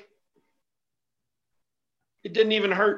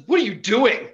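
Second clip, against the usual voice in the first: mock startled demand; 7 semitones higher, 5 dB louder than usual. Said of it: "He says".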